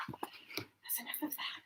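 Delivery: slow breathy whisper of "That's enough of that". Shown as a demonstration.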